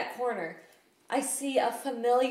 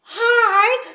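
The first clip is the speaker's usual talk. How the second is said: a very high, loud squeaky hi; starstruck hi; 13 semitones higher, 14 dB louder than usual. Retold or imitated. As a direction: blurt it out, starstruck. Starstruck reply to actor.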